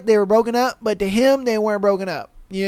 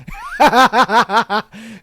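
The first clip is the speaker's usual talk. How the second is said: hearty laugh. Real, not acted. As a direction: burst out in a long cackle. Reacting to the story he reads.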